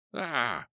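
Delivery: sharp high cry of pain; sudden high pain yelp. A narrator's reading. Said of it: "I cried".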